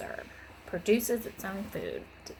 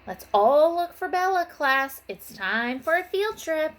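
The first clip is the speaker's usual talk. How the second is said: high, loud, rousing call; rally the class for an adventure; excited announcement; 8 semitones higher, 6 dB louder than usual. Acted. Miss Frizzle announcing a field trip to the class, high and loud.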